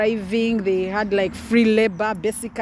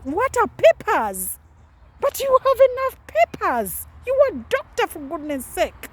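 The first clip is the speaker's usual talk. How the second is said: put-on much higher, louder old grandmother in disbelief; grandmother's disbelief; 12 semitones higher, 3 dB louder than usual. Grandmother on the phone refusing to understand, high incredulous voice. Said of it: "she asks".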